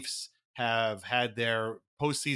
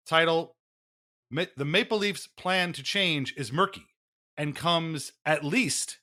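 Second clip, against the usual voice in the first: grand reading over music; mock-grave literary reading; dramatic article reading; 3 semitones higher than usual. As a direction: read it like a solemn literary broadcast.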